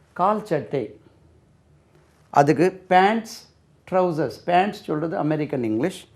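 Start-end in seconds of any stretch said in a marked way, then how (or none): none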